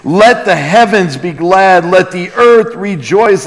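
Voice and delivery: ordinary delivery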